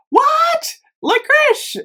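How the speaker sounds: high, surprised disbelief. Real, not acted. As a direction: genuinely caught off guard. Surprised by the scent name.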